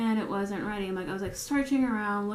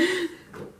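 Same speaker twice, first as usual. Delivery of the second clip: short high laugh; real giggle mid-story; 9 semitones higher than usual. Real, not acted. About to tell coworker's story, high amused laugh.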